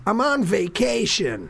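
a hint of a fed-up action hero; John McClane. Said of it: "John McClane goes".

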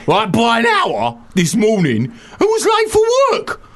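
hammed-up Cockney outrage. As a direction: bellow the complaint like an affronted Cockney. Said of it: "Can we do your Cockney?".

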